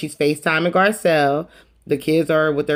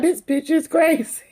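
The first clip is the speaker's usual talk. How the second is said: incredulous; 14 semitones higher than usual. Retold or imitated. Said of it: "Kyle just looking at her like".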